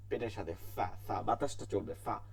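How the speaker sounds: teacher showing ফা; fa demo. Shown as a demonstration. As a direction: let air hiss through for ফা. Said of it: "দুই দাঁতের আগা সামনে নিচে".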